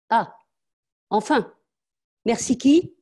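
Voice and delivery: mock her half-thanks; sarcastic scolding.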